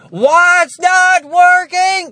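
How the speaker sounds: slow, high, loud shout; yelling back to his wife from the next room. Retold or imitated. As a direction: bellow it across the house, drawn out. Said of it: "I'm not going to shout back".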